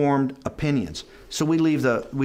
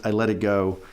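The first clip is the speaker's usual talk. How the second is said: ordinary talk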